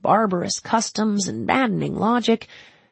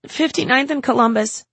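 put-on higher, louder young woman; Alice's voice; 8 semitones higher, 5 dB louder than usual. Alice answering Ezra from the street, higher strained voice.

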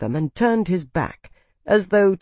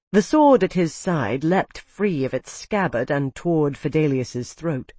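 ordinary delivery

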